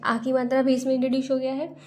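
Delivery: ordinary talk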